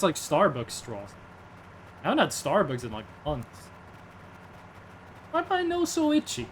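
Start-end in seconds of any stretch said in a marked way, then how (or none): 5.3-6.5 whiny silly voice. Pretend. Itchy nose complaint.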